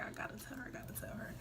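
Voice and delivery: soft unvoiced whisper, half under her breath; whispering to herself before telling her friend. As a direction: whisper it to yourself, nervous. Inner urge retold.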